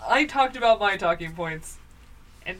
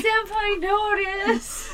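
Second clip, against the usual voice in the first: high laughing squeaky plea; laughing cat voice; 12 semitones higher, 3 dB louder, much slower than usual. Pretend. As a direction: beg for attention like a lovesick cat, laughing.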